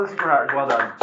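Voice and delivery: ordinary talk, nothing marked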